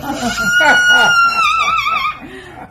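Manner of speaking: loud high burst of laughter; shrieking laugh. Real, not acted. Owner laughing loudly at her parrot's car alarm. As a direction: shriek with delight. Delighted by the bird's sound.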